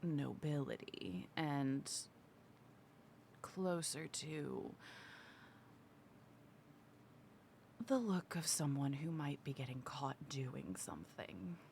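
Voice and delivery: hushed conspiratorial whisper. Acted. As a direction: whisper it like a sneaky rogue. Rogue whispers in character.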